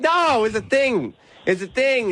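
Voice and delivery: high-pitched, drawn-out mock protest; defensive protest. Husband excusing himself to jealous Jess, mock defensive protest. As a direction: plead innocence, pitched well up.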